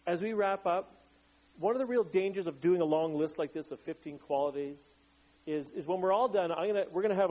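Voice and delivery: ordinary talk, nothing marked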